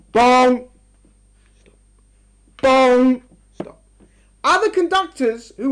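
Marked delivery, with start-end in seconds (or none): 0.1-0.7 a loud, blunt sung bong; rigid thunder bong. Shown as a demonstration. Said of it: "Called like this". 2.6-3.2 a loud, blunt sung bong; rigid thunder bong. Shown as a demonstration. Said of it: "Called like this".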